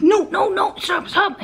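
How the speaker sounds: high, urgent calls after the dog; frantic calling. Retold or imitated. Owner calling her dog back in the hallway, high and urgent. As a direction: stop the runaway dog in a panic. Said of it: "So I'm like".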